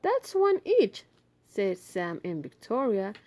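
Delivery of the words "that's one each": put-on kids counting. Children voiced in story.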